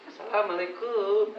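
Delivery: greeting at rising pitch. Shown as a demonstration. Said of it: "Naik ke nada dua".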